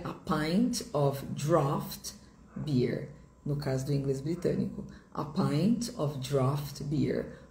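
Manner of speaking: slow, careful a pint of draft beer; slow teaching model. Shown as a demonstration. Teacher modelling a pint of draft beer slowly. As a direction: stretch out every word. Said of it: "slow model phrase".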